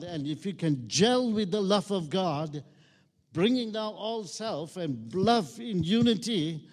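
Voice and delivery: full-force revival preaching. Acted. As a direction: build the congregation up to a shout.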